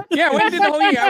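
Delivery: laughing through the words, quick and high. Real, not acted. Ex-con laughing at his own sentence being echoed back. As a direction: chuckle it out as you repeat the joke. Laughing, repeating the mock echo.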